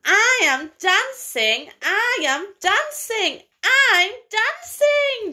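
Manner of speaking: a giraffe overjoyed to be dancing; joyful cry. Acted. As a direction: let the delight build on each repeat. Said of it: "Gerald cried".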